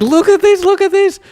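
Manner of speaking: very high, loud squealing insistence; shrill out of sheer scandal, pointing; hammed-up high outraged monk.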